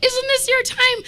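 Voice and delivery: high eager question in a mock coworker voice; imitating coworker. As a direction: lift it up like the curious coworker. Coworker quoted, high-pitched.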